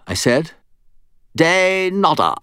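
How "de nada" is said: high, drawn-out skull voice; talking skull. A narrator's reading. Bob the skull answers Harry.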